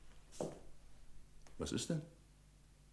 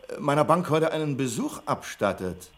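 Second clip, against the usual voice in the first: indignant demand; 6 semitones higher, 4 dB louder than usual. Acted. Manager confronts head office on phone.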